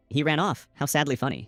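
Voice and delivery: usual speaking voice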